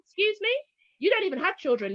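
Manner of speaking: affronted, high-pitched "excuse me"; retold indignation. Mother silently answering a childless colleague, indignant. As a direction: start high in disbelief, then settle into a telling-off.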